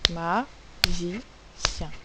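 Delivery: "Magicien" split into ma, gi, cien with clear pauses; very slow, separated syllables in "Magicien". Shown as a demonstration. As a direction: break "Magicien" into three beats for pupils. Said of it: "trois syllabes".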